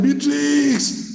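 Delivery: high, long-drawn call of the name; acted-out urgent holler; imitated pastor's shout. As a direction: shout it out as if summoning help, stretching the last syllable. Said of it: "Pastor shouted".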